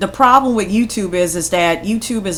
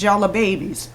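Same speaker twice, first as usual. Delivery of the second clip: ordinary delivery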